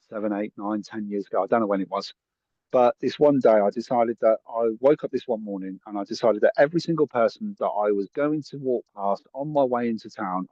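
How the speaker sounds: normal talking voice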